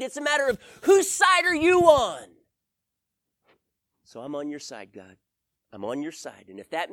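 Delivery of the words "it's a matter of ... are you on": raised, direct rebuke from God; a stern voice of God, built to a loud close; firm God. Retold or imitated. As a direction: speak as God setting him straight, loud and sure. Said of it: "He was so firm with me".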